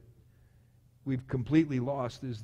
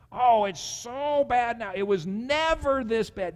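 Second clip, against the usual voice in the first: mock complaining; 6 semitones higher, 5 dB louder, slower than usual. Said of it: "we have a tendency to think".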